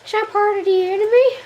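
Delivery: high, loud silly character voice; odd put-on voice. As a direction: squeak it out like a goofy video game enemy. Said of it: "why is your voice like that?".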